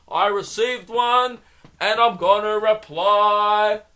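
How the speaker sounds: slow, high, loud bellowed announcement; mock overexcited shouting. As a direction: shout it to the whole office, words stretched out. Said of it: "who gets that excited over a text?".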